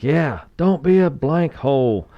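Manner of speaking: slow, high mock coach shout; coach repeating the shout. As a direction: hammer the rule home like a coach. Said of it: "the coach reiterates it".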